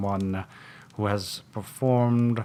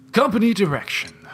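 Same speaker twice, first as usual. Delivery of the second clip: high blurted line; 11 semitones higher, 7 dB louder, much faster than usual. Acted. Detective blurts in odd voice.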